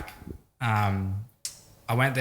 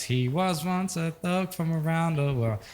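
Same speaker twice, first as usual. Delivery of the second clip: higher, drawn-out mimicry of the record; put-on high, stretched-out intro voice; imitating song intro; 7 semitones higher, slower than usual. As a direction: quote the track's intro slowly, pitched up. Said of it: "at the start when it goes".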